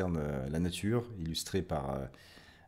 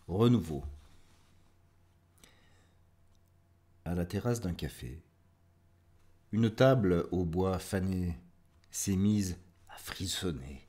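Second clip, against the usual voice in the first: measured verse recital with spacious pauses; poetry reading. Acted. Host reading listeners a poem about renewal, measured poetry recital. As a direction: recite line by line, endings dropping away.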